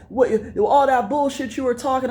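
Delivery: high, agitated imitation of a guy going off; mock angry rival. Comic imitating a rival confronting him, high and agitated. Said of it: "And he's like".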